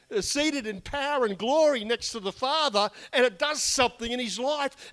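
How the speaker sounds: rally the congregation with fervour; fiery preaching. Acted. Preacher builds to full force.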